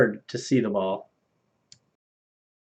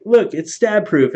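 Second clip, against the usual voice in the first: hammed-up boast, higher and louder; mock boastful friend; 9 semitones higher, 6 dB louder, slower than usual. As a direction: show it off like a proud idiot. Retold boast before stabbing.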